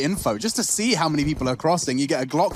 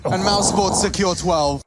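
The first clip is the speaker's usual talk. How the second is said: excited; 6 semitones higher than usual. Real, not acted. Excited caster climax.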